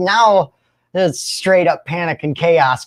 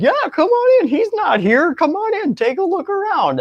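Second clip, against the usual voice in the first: much higher, breezy fake-innocent voice; buddy letting cops in. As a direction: play the friend inviting cops in, high and casual. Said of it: "dude's like".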